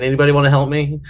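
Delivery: usual speaking voice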